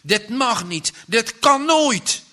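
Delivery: hoog, luid en radeloos; wanhopig roepen. A narrator's reading. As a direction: schreeuw het ongeloof uit. (wanhopige kreet, wat trager)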